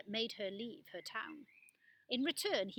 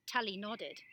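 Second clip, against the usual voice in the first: ordinary delivery, nothing marked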